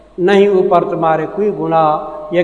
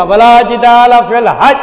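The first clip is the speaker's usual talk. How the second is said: retold shout, higher and louder; loud call; 5 semitones higher, 6 dB louder than usual. Calling out to two women fighting with shoes, a loud retold shout. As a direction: boom the verse out to stop a fight. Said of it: "تو میں نے زور سے کہا".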